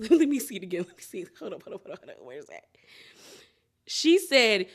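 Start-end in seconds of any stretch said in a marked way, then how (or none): 0.1-2.6 much softer, lower muttering; quiet aside while searching; muttering to self. Real, not acted. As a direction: mumble while scrubbing the video.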